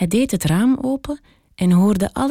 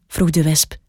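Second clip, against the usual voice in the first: ordinary delivery, nothing marked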